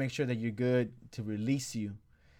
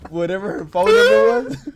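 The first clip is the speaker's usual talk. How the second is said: louder, higher words broken by real laughter; laughing; 10 dB louder than usual. Real, not acted. Telling friends the jail story, laughing out loud. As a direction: laugh through the line.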